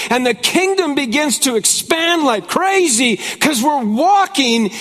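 preaching scripture. Acted. Pastor preaching the outpouring to his congregation. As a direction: proclaim the promise with rising fire.

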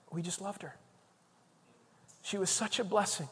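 soft, hushed imitation of mourners; tearful tenderness. Nurses at their patient's funeral, understated tearful tenderness. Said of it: "there were tears in these people's eyes".

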